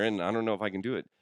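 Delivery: ordinary delivery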